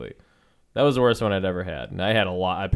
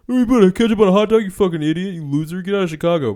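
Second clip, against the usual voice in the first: high, fast, sneering food snob voice; whiny gatekeeper mockery; mocking snob; 9 semitones higher, 6 dB louder, faster than usual. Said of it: "let 'em eat the".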